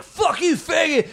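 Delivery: high-pitched imitation of a yelled insult; mock heckler's shout. Storyteller mimicking a heckler who expects rage, mock shout.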